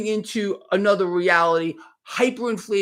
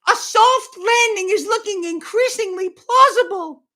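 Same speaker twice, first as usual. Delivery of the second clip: high, loud put-on voice; mocking read-out; 11 semitones higher, 5 dB louder than usual. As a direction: ridicule the headline in a silly high pitch. Mockingly reads a soft-landing headline.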